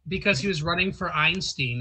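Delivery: ordinary delivery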